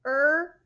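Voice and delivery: er, sustained R; held R sound. Shown as a demonstration. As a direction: hold the er sound out. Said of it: "you're holding that R sound".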